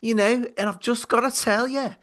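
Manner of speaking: voice of Celeste. Acted. Imagined producer Celeste scolding the two hosts, high put-on voice. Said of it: "maybe this could be the voice of Celeste".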